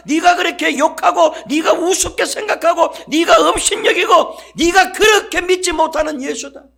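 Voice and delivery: preacher shouts Jesus' words for the church to repeat; thundering proclamation. Acted. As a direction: boom each line like Jesus rebuking Saul. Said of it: "따라합니다".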